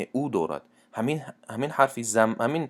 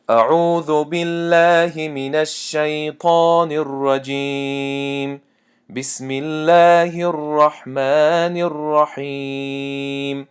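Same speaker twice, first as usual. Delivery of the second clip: slow, drawn-out chanted recitation, higher and louder; Quran recitation; 5 semitones higher, 4 dB louder, much slower than usual. Shown as a demonstration. Teacher chanting verses for students, slow melodic recitation.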